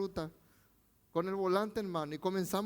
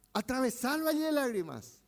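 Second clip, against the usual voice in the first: raised preaching; 8 semitones higher than usual. Acted. Preaching peak again.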